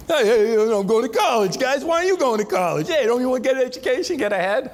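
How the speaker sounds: hammed-up Jersey guy hollering at his buddies; imitating a loud Jersey kid bragging about college; loud New Jersey accent. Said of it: "they don't talk like that here in college".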